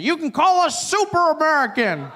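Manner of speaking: boastful call across a crowd; retold shout. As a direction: shout it out like a proud Southern gentleman. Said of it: "a gentleman shouts it out".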